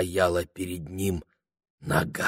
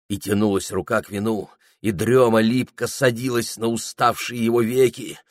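ordinary delivery

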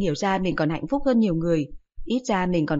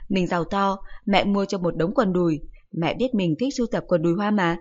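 ordinary delivery, nothing marked

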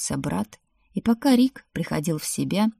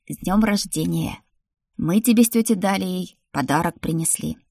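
ordinary delivery, nothing marked

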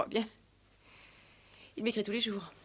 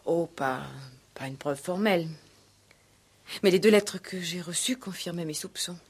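louder, insistent defence; defensive, louder; 8 dB louder than usual. Acted. Fiancée defending her suspicions to the commissaire, louder. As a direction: push back, voice raised.

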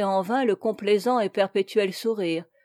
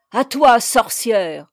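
slow, loud battle cry; battle challenge; 2 semitones higher, 8 dB louder, slower than usual. A narrator's reading. Captain lunging at an imagined witch, loud slow challenge. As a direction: hurl the challenge loudly and draw it out.

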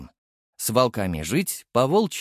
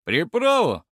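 surprised; 7 semitones higher, 3 dB louder than usual. A narrator's reading. Worker echoes colleague's question.

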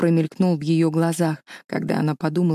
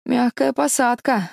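slow, higher exclamation over rain; exclaiming over rain; 6 semitones higher than usual. Acted. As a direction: call it out through the storm. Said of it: "воскликнула сквозь шум дождя Эстелла".

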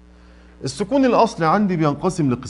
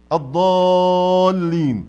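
الضالين, slow, with a long level aa; long obligatory madd; much slower than usual. Shown as a demonstration. Teacher giving an example of madd, long-held aa in الضالين. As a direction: hold the aa of الضالين long before the doubled lam. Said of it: "زي".

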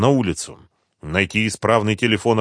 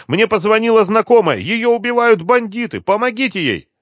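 very high, frantic plea; loud despair, strained high; desperate cry; 13 semitones higher, 5 dB louder than usual. A narrator's reading. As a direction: beg the police for help. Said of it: "крикнула в отчаянии Света".

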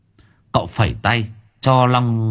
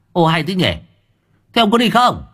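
snapping irritably; 10 semitones higher than usual. Acted. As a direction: bark it out in exasperation. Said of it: "Cậu gắt". (gắt gỏng, cao giọng quát)

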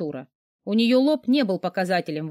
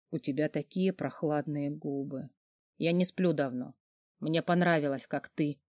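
lower, softer sleepy murmur; drowsy sick boss; 4 semitones lower, 7 dB quieter than usual. Acted. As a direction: murmur half-asleep and tender. Boss speaks softly to her.

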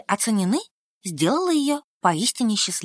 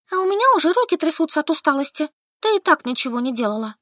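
быстрее и выше, с наездом; налететь на подругу с упрёком; напористо; 6 semitones higher, faster than usual. A narrator's reading. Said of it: "Налетела на подругу Солька". (напористый упрёк)

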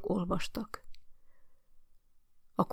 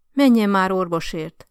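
impatient demand; 8 dB louder than usual. A narrator's reading. Old lady orders doctor.

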